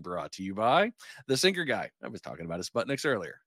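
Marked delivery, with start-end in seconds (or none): none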